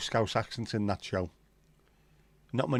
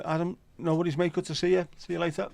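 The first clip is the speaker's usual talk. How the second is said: cheery greeting; 7 semitones higher than usual. Real, not acted. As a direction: sing out a welcome. Greeting a viewer on stream.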